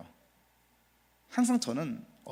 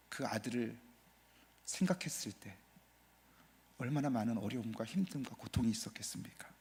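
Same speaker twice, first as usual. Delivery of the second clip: hushed grief; 4 semitones lower, 10 dB quieter than usual. Acted. Pain of giving up son.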